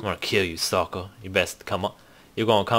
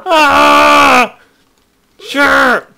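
very high shrieking laugh-scream; screaming at a spider; 12 semitones higher, 7 dB louder than usual. Real, not acted. Spider attack in game.

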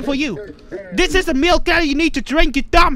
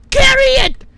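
high, loud squealed order; frantic yell; 10 semitones higher, 7 dB louder than usual. Real, not acted. Player trying to get the cookie moved, frantic high yell. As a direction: cry it out in frustration.

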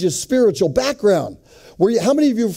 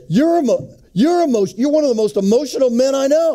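imitating an indignant wife, pitched up high; higher, insistent retort with stammered false starts; raised voice; 7 semitones higher than usual. Wife turns the charge on her husband, in a raised voice. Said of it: "she raised her voice a little bit".

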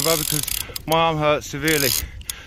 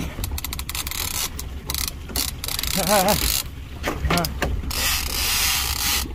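breathless laugh; 4 semitones higher, 5 dB quieter than usual. Real, not acted. During the fight.

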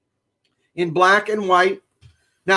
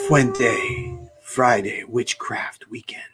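breathy whispered spooky ghost; slow eerie ghostly whisper; 5 semitones lower, 4 dB quieter, slower than usual. Pretend. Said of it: "do I have the ghost voice?".

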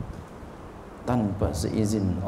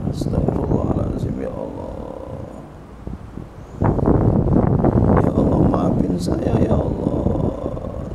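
husky, breaking plea for forgiveness; acted tearful istighfar. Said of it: "Dia istighfar".

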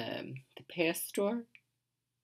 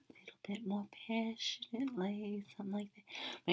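whispering; 3 semitones higher, 9 dB quieter than usual. Real, not acted. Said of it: "I'm talking so quietly".

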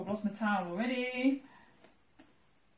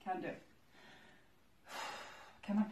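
quiet; 3 semitones lower, 13 dB quieter, faster than usual. Real, not acted. Self-doubt after sets.

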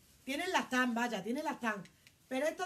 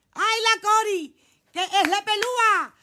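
loud, laughing call-out of a viewer's name; excited shout; 5 semitones higher, 12 dB louder than usual. Real, not acted. Calling out to the live viewers, loud.

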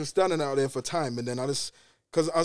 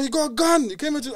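mock yell; 10 semitones higher than usual. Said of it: "He said".

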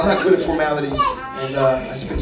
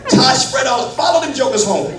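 laughing, then louder and higher to a shout; laughing preacher building to a shout; 10 semitones higher, 9 dB louder than usual. Acted. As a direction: joke with them, then build to a loud, higher call. Laughing, rising to full-force preaching.

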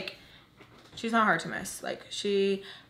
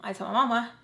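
normal talking voice

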